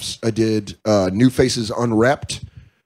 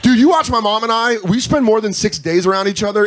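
normal talking voice

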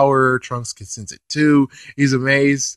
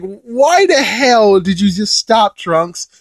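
slow, higher, louder demand; exasperated yelling; 7 semitones higher, 4 dB louder, slower than usual. Retold or imitated. Fan scolding Trunks again, slow exasperated yell. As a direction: drag it out in outrage. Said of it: "It's like".

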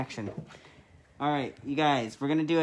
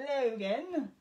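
high-pitched mock-British name call; amateur British impression; attempted British accent; 9 semitones higher, 3 dB quieter than usual. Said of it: "if that's a very good British accent".

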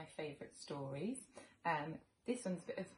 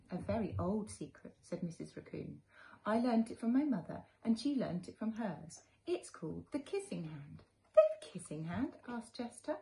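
put-on gentle read-aloud for little children; storybook narration; 2 semitones higher, 7 dB louder than usual. A narrator's reading. Teacher reading a picture book to children, gently. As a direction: tell it like a bedtime story to kids.

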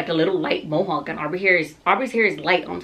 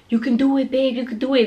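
higher, pleading pep talk; urgent encouragement; 7 semitones higher, 3 dB louder, faster than usual. Retold or imitated. Partner to exhausted laboring mom, urgent encouragement. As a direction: imitate a partner cheering her on. Partner urging her to push.

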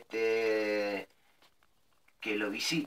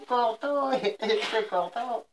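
laughing; 12 semitones higher, slower than usual. Real, not acted. Prank victim hangs up.

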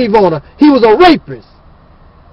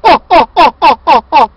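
mock laughter. Mocking laughter at opponents.

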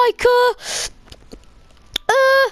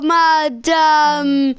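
ordinary delivery

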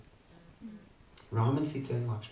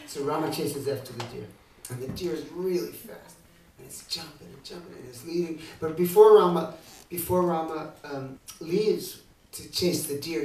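usual speaking voice

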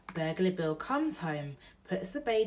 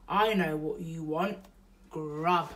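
burglar voice; 4 dB louder than usual. Acted. Bill voiced in read story.

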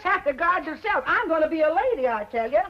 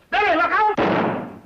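warning shout; 4 semitones higher, 4 dB louder, slower than usual. Acted.

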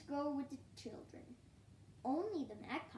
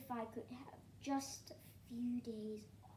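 whispering. Acted. Owl's tired wish.

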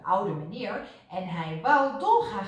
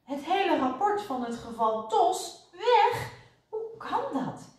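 high, aghast disbelief; flustered and stunned, voice shooting up; bewildered; 10 semitones higher than usual. A narrator's reading. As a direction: gasp out the loss in dismay. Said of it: "Ze keek verbijsterd".

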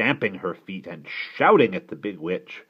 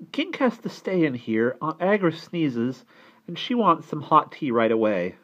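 put-on high, louder child's voice; urgent child; 10 semitones higher, 6 dB louder than usual. A narrator's reading. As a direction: pass on the orders urgently. Child passes on urgent request.

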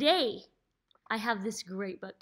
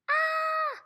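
scared ant shout. Shown as a demonstration. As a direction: yell "Ah" like a frightened ant, hands in the air. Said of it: "rub your tummy".

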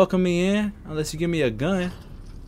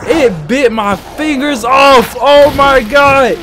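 high, loud screams of shock; terrified shouting; 7 semitones higher, 7 dB louder, much slower than usual. Real, not acted. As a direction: shriek in horror as it bites. Monster bites off fingers.

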